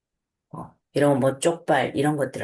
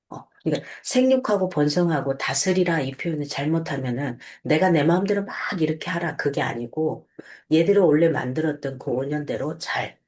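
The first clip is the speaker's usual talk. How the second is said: ordinary talk, nothing marked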